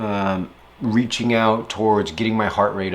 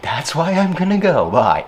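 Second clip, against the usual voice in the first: breezy brush-off; 7 semitones higher, 5 dB louder than usual. Retold or imitated. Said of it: "And I'm like".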